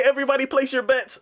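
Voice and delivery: fast, high announcer imitation; mock catchphrase delivery. Asking whether the host said it that way, mock hype call. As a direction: call out bets in the silly hype voice. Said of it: "in that way".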